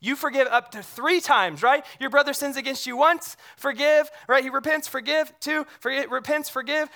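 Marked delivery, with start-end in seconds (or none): none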